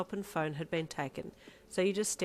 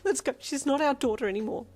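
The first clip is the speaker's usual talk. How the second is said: distraught cry; 9 semitones higher, 9 dB louder than usual. Acted. Mum drags dad out distraught.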